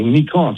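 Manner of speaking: "Nikon" alone, as "nee-KON"; Japanese-style Nikon. Shown as a demonstration. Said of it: "how you pronounce it in Japanese".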